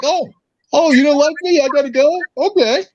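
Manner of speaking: high, mock-innocent whine, pitched well up; mock meek dictator. Mocking a dictator who meekly leaves office, high and whiny. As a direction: play the dictator shrugging off being voted out, high and simpering. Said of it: "You're just like".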